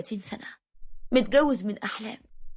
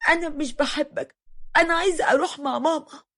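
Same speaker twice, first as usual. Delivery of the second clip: lightly acted tearful little girl, higher pitch; understated child's wail, pitched up; crying child; 6 semitones higher than usual. A narrator's reading. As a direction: protest like a hurt daughter, mildly weepy. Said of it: "ودنيا بنتي فضلت تعيط وتقولي".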